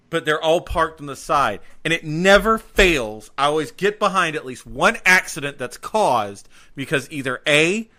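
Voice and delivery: ordinary talk, nothing marked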